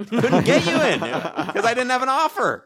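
high laughing line. Real, not acted. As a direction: say it while laughing. Joking, laughing.

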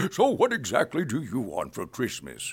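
put-on gentle, kindly Santa asking a child; Santa Claus voice. Santa asks the child for a Christmas wish, kindly. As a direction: lean in like Santa on his lap. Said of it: "doing Santa Claus".

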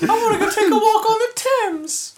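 put-on very high, loud British baby talk; mock British baby. Co-host playing a baby wanting a Thames walk, loud high British baby voice. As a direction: shriek like a British toddler. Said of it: "He's British".

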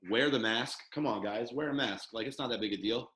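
ordinary talk, nothing marked

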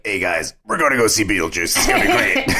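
raspy, hammed-up character voice; Beetlejuice impression. Co-host finally shows off the impression, raspy character voice. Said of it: "do my Beetlejuice impression".

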